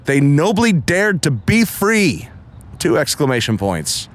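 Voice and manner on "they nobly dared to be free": rousing reading. Acted. Reading an exclaimed inscription line.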